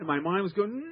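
usual speaking voice

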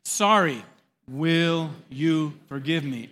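loud, slow, word by word; spaced-out, emphatic group prompt; leading a recitation. Shown as a demonstration. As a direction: lead the room in repeating it. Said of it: "Say those words with me".

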